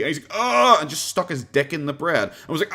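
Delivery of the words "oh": loud, high-pitched panicked "oh"; hammed-up cry of confusion; mock baffled "oh". Subway worker asked for an Italian BMT, mock baffled.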